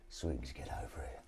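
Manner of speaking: low, quiet henchman-summoning villain; failed Blofeld impression. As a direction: attempt a hushed, low Bond villain. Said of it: "That wouldn't be Blofeld".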